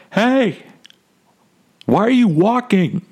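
high mock yell from the chairlift; kid screaming down. Fourteen-year-old kid on the chairlift yelling at him, high and shrill. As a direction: call down like a bratty teen. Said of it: "He screams down at me".

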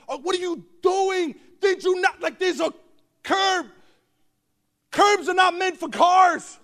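high, emphatic exasperation; acted scolding. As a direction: scold the driver in mock outrage. Acted scolding of wife at wheel.

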